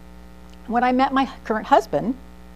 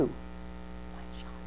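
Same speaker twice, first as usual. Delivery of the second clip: whispered; 16 dB quieter than usual. Retold or imitated. Grandma's weary answer.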